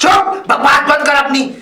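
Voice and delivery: angry silencing. Acted. Father-in-law ordering daughter-in-law to shut up, angry. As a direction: cut them off with a shout.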